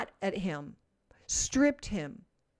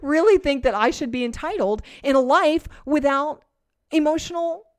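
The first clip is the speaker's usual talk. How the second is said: higher and louder, laughing, hammed-up self-pity; a pretend confession, whined high and loud with a laugh; mock entitled whine; 5 semitones higher, 6 dB louder than usual. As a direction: play the spoiled self-absorbed believer, over the top. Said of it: "what if we just said".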